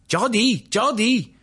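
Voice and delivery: high-pitched imitation Hindi accent. A narrator's reading. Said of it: "in a Hindi accent".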